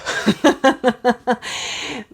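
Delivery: quick amused laugh, high; a brief chuckle at herself; short laugh. Real, not acted. Laughs at own remark.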